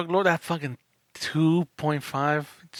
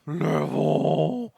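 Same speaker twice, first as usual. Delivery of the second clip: slow, drawn-out growl on threat level; middle growl; much slower than usual. Shown as a demonstration. Co-host demos a growl for the threat level segment, slow and drawn out. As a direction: growl the words like a show bumper. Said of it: "I kinda wanna do a middle growl for threat level".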